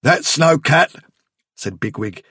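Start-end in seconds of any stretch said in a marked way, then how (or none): 0.0-0.9 high, loud denial; tense, frightened contradiction, raised voice; stiff dread. A narrator's reading. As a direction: insist through a stiff grimace. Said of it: "lips drawn back in a stiffened, unnatural grimace".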